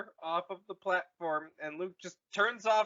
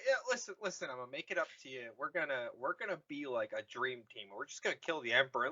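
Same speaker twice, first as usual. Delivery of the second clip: fast wheedling pitch; 5 semitones lower, 5 dB quieter, faster than usual. Acted. Vader's rushed pitch.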